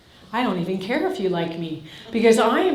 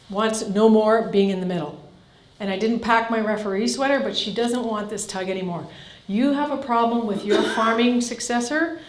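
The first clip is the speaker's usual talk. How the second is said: ordinary talk, nothing marked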